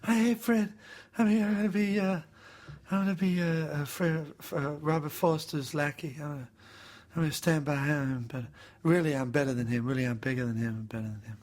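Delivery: a hint of Seagal, half-mocking; Steven Seagal impression. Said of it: "I'll do Seagal".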